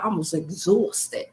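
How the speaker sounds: slow weary drawl on exhausted; a tired stretched-out sigh of a line; 1 drawn-out exhausted. Shown as a demonstration. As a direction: let it sag like you're spent. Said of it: "I could just say it like a time".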